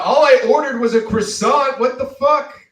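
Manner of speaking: high, loud indignant yell; retold yell. Yelling at a café server over a lone croissant order. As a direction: shout it out in outrage. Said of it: "I just yelled out".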